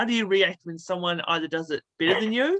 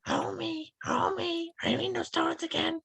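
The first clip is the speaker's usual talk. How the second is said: very high, softer cartoon housewife; Marge Simpson; 11 semitones higher, 6 dB quieter than usual. Host testing guest with an impression, very high cartoon wife voice. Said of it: "that little Marge Simpson".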